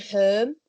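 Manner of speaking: "Home" said as "herm"; Hull er vowel. Retold or imitated. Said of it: "So the ers still cause me a problem".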